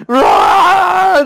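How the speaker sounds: high shouted mock roar; hammed-up sea-monster yell. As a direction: burst out of the water roaring. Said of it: "picturing this big lumbering guy".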